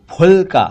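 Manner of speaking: फुलका alone, as "p-hulka"; strong lip puff on फुलका; aspirated ph. Shown as a demonstration. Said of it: "फुलका कहना चाहिए".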